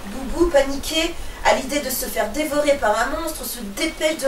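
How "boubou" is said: ordinary delivery, nothing marked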